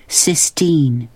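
plainly stated name with falling end; girl stating her name. Acted. New girl tells the boys her name, plain firm statement. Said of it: "she said slowly".

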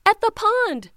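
high, loud panicked start; frantic panting. Acted. Teeny telling her brothers, frantic and out of breath. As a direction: gasp it out in a panic. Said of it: "she panted, frantic".

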